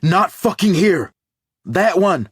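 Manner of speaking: sharp barked order, loud and high; urgent commanding shout. A narrator's reading. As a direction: shout the order over the noise. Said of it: "Linda orders".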